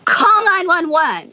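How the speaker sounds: high, drawn-out urgent call; imitated emergency yell, not at full volume; retold screamed order. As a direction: call it out like an army sergeant. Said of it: "I start screaming at people".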